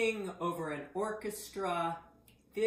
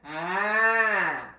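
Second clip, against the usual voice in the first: loud, open front-placed "ah"; forward ah; 11 dB louder than usual. Shown as a demonstration. Voice teacher moving back to front, loud forward ah. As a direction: bring the sound forward and project. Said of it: "then come forward".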